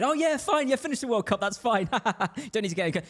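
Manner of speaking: high-pitched, airy mock reassurance; mock breezy acceptance. Club naively trusting the player, mock breezy. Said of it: "we were just like".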